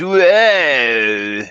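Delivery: loud, high Californian surfer whoa. Pretend. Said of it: "I just do the Californians".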